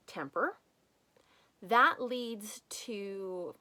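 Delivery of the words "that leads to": very slow repeat. Shown as a demonstration. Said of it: "slow list emphasis".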